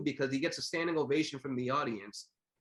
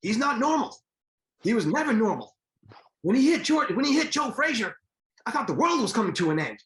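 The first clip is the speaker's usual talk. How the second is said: much higher and louder, awed quote of a commentator; excited retelling; 9 semitones higher, 5 dB louder than usual. Retold or imitated. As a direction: quote him like the world is ending, wide-eyed. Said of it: "Ralph Wiley said it best".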